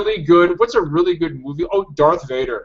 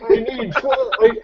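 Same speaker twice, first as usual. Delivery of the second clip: much higher, slow, pompous lecturing voice; Bane as lit professor; 8 semitones higher than usual. Retold or imitated. Bane assigning homework to students, as a pompous professor. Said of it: "sound like my college English lit professor".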